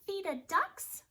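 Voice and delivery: a tiny cub piping up; high eager baby bear. Acted. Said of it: "said Baby Blair".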